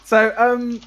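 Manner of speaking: laughing through the words; breaking up with laughter. Real, not acted. Laughing fit on stream.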